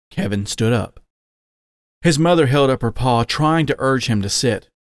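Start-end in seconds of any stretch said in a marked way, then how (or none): none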